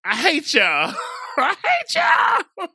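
loud, high, laughing fake outrage; laughing mock rant. Cousin retelling his outburst at the family, loud laughing mock rant. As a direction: rant through laughter, hamming it up.

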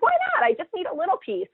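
high, pleading mock whine; whiny plea. Retelling asking the surgeon for the tumor, high pleading whine. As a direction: beg like a kid denied a treat. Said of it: "And I was like".

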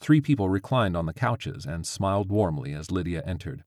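plain reading voice

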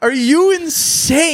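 high, drawn-out disbelief; incredulous outburst. Acted. Man arguing with his ex, high exasperated disbelief. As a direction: pitch it way up in exasperation.

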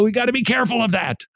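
high, quick, mock-timid hedging; namby-pamby caution. Host mocking the other side, namby-pamby caution. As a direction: play at being overcautious. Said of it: "don't wanna become namby-pamby".

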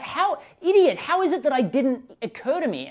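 high, loud self-scolding; mock yelling at himself. Telling the host how he scolded himself, in a high, loud voice. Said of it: "And I'm like".

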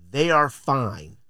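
ordinary delivery

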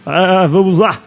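Galvão Bueno impression. Podcaster parodying the match opening for laughs, announcer impression. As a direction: open the broadcast like a booming football announcer. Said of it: "começa a narrar igual o Galvão Bueno".

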